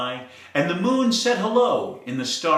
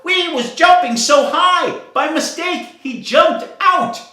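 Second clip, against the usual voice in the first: high, loud excited fish; high shouted explanation; shouting; 13 semitones higher, 7 dB louder than usual. Acted. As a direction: shout it out in a fluster. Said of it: "the fish started to shout".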